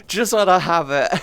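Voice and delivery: hammed-up mum, loud and final. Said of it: "my mum would just be like".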